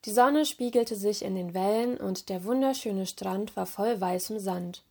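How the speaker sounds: ordinary delivery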